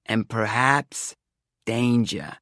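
slow "perhaps", dramatic pause, then "danger"; paused for emphasis. A narrator's reading. Seer adds "danger" after a pause, portentously. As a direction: draw out "perhaps" and set "danger" apart. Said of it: "she paused, doing the old emphasis thing".

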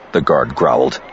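plain reading voice

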